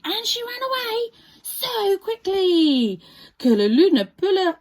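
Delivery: ordinary delivery, nothing marked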